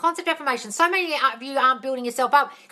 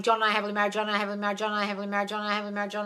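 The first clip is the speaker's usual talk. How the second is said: flat, rote repetition, no meaning; like a robot; 3 semitones lower, 5 dB quieter, much faster than usual. Shown as a demonstration. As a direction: drone it like gobbledygook. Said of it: "Affirming like a robot is going".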